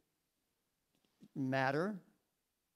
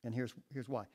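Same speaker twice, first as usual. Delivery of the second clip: normal talking voice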